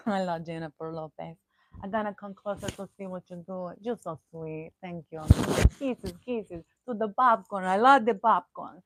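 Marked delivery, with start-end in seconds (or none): 1.8-8.8 playful put-on celebrity voice. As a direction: gush at the fans like a flamboyant star. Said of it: "do this voice for a little while 'cause I'm talking about [name]".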